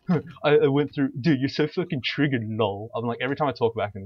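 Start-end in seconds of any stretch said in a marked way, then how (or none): 0.1-2.9 loud higher whiny imitation of a toxic teammate; mocking a raging player. Retelling a flaming teammate, in a mocking loud whine. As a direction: ham up the entitled gamer. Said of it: "He's like".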